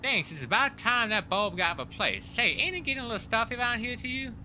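high-pitched Texan character voice; Texan accent. Acted. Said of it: "you gotta go full Texan".

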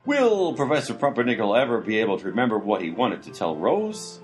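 usual speaking voice

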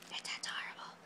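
whisper. Real, not acted. Whispered gushing.

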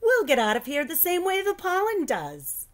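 confident teacher reassuring the class, cheerfully. A narrator's reading. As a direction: sing it out like it's a fun outing. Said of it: "she said cheerfully".

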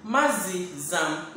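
"Mazizam" drawn out slowly; slow demo. Shown as a demonstration. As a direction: stretch "Mazizam" so learners hear it. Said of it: "You can say Mazizam".

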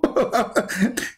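short high burst of laughter; amused laugh. Real, not acted. Laughs at caller hanging up.

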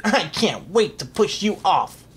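villainous duke's threat; snapping. A narrator's reading. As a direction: threaten him in a put-on villain voice. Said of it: "snapped the Grand Duke Wilfred".

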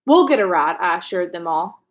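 usual speaking voice

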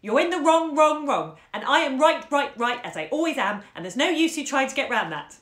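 loud, high overbearing chant; loud bullying singsong; domineering. Acted. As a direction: hammer it in like a tyrant. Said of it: "What the children distinctly heard was".

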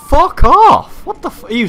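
high, loud outburst; sudden angry shout. Real, not acted. Player cursing an enemy who interrupts him, shouting. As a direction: explode mid-sentence, high and loud.

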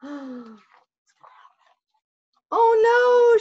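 high, loud cry of alarm; shout. Acted. Bear sees the egg rolling, shouting. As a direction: yell out in panic. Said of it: "shouted Bear".

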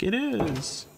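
high, put-on insistence; insistent. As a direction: squeak it out in mock protest. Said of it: "and I'm like".

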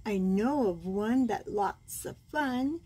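plain reading voice

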